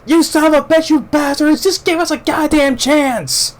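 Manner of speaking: high, raw enraged yell at the enemy; furious outburst. Retold or imitated. Medic Giovanni cursing the shooters after losing his patient, raging. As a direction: scream it out at the shooters, shaking. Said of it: "Giovanni's like".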